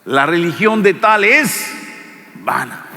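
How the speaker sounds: solemn preacher reading Santiago aloud; solemn scripture reading. Acted. Reads scripture aloud in sermon.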